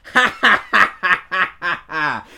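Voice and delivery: fake laugh. Acted laugh inside read review.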